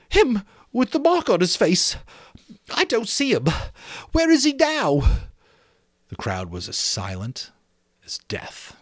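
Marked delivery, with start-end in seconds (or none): 0.1-5.0 put-on high, far-off fretful boy's voice, well above the narrator; a put-upon, indignant schoolboy, much higher; Piggy's whiny voice. Piggy scolding the boys for rushing off, high indignant boy voice.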